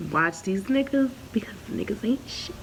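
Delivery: whispered aside to viewers; conspiratorial whisper. Real, not acted. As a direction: lean in and whisper the warning.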